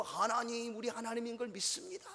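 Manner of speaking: high-pitched, put-on pious declaration; mock a show of faith for onlookers; showy public prayer. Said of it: "유대인들처럼 시장 어귀에서 두 손 높이 들고".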